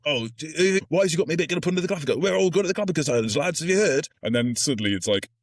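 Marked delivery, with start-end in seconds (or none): 0.0-4.1 high, fast, louder mock gossip; gossiping crewman. Crew spreading the pudding rumour, high fast mock gossip. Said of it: "heard it through other people".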